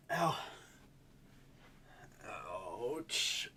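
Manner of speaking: hammed-up pain groans; playing up the pain. Real, not acted. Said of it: "I'm only playing this up slightly".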